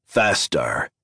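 lightly voiced alien captor; a hint of a monster giving orders; marauder's command. A narrator's reading. Marauder lifts a prod at Addie, commanding.

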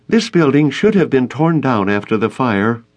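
high, cross complaint; crossly. A narrator's reading. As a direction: scold the idea with irritation. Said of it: "Gloria said crossly".

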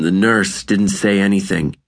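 plain reading voice

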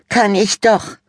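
put-on high, loud teen girl insisting; bickering sister. A narrator's reading. Sister bickering with brother, high and loud. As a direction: stamp the foot on doch.

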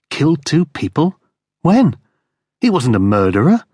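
disbelieving, rising question; incredulous echo. Acted. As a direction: throw the words back in disbelief. Darren echoing in disbelief.

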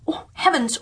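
a first small gasp of wonder; mild astonishment. Acted. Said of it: "cried they".

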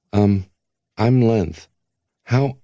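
put-on a confused young man introducing himself; young Length. A narrator's reading. Young Length, unsure, speaking toward the glass. Boy Length meets hidden voice.

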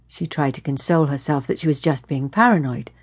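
ordinary delivery

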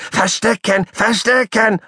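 pestering squeak; squealed, rising. Acted. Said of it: "immer lauter gequiekt".

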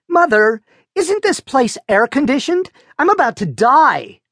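put-on whiny teen sister. A narrator's reading. Teen sister complaining about heat.

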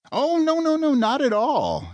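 embarrassed high-pitched backpedal; flustered denial. A narrator's reading. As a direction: protest too eagerly. Adam embarrassed, denying.

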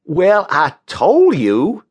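high, drawn-out I-told-you-so; smug character voice, slow and high; gloating. A narrator's reading. Wims gloats after the crash.

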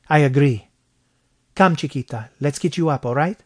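put-on Mexican-accented man taking charge. A narrator's reading. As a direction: coax her up in a Mexican accent. Gabriel helps the hurt girl up.

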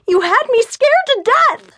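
high, upset yelling; furious yell. A narrator's reading. Fiona scolds Gabe after her scare, furious yell. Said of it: "I yelled as he pulled me along".

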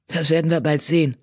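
ordinary delivery, nothing marked

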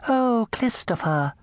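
high, slow lisping coo. Acted. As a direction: coo it sweetly with a lisp. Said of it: "they would coo in lisping voices".

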